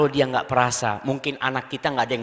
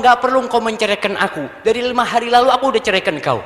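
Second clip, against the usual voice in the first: louder, fast retort with a higher voice; mock angry husband; 6 semitones higher, 6 dB louder, faster than usual. Husband retorts to the wife who threatens divorce, louder. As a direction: snap back like a husband in a quarrel.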